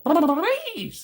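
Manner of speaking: high, loud, pleading cartoon voice; high whiny begging Roger; imitating Roger Rabbit. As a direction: beg like a frantic cartoon rabbit. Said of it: "He's just like".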